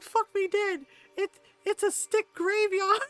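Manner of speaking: high, loud mock horror; hammed-up terror, rising at the end; panicky horse. Horse sees a stick graveyard, mock horror. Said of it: "he's like".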